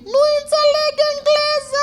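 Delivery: very high, loud panicked shriek; hammed-up high-pitched yell in Romanian; imitated frightened shouting. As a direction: screech it like a woman fleeing. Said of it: "I could hear her shouting".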